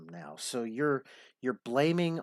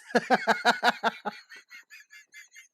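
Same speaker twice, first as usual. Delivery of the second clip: high laugh; 12 semitones higher, 4 dB louder than usual. Real, not acted. Host laughs after his joke.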